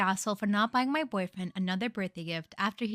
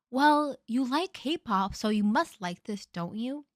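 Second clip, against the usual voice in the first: mocking clueless partner; 3 semitones higher, much slower than usual. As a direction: drag it out like a know-it-all partner.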